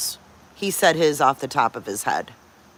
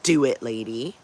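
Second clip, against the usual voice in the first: a lower, put-on voice for the quoted phrase; mock quote voice; 4 semitones lower than usual. Retelling last night's accusation, mock voice. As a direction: mimic the line she supposedly said. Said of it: "she's like".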